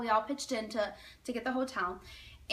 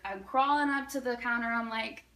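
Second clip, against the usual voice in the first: ordinary delivery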